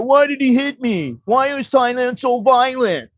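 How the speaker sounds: mock the sore loser lying on the ground; mocking the beaten guy. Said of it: "the guy's laying there".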